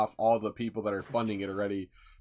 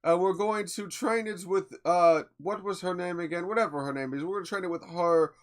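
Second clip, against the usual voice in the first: greedy studio exec; 5 semitones higher than usual. Retold or imitated. Voicing greedy studio execs.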